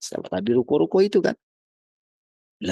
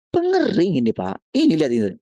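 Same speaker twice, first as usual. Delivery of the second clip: pushy seller; 8 semitones higher, 4 dB louder than usual. Retold or imitated. Shop seller insisting on wrong part.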